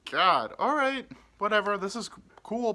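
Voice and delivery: high, loud, flustered exclamation; acted rising fluster; overwhelmed exclamation.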